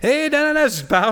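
high-pitched comic retelling; animated retelling. Retold or imitated. Telling the co-host of meeting a French friend, high animated imitation. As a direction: pitch it way up, mocking your own chatter. Acting out sudden accent switch.

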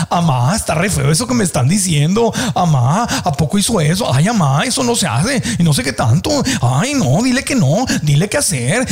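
put-on whiny little kid from Tijuana, pitch swooping; little boy's inner voice. His inner child voice complaining about what is heard, whiny. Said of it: "es mi vocecita de niño".

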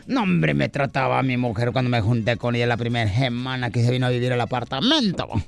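very low put-on man's voice; voiced buddy character. DJ recalls the first week living together, low character voice.

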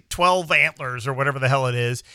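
usual speaking voice

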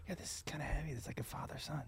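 whispered, embarrassed mock excuse; hushed sheepish excuse. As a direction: act out whispering a meek explanation to a date. Meek excuse to date while crying.

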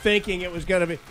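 normal talking voice

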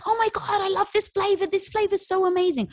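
imitating thrilled customers squealing praise; gushing fan. Customers raving online about the new flavor, high mock gushing.

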